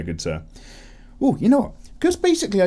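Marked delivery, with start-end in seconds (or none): 0.0-0.4 low, soft mock-formal sir; posh gent voice. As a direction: drop low like a gentleman. Mock-formal reply to a viewer.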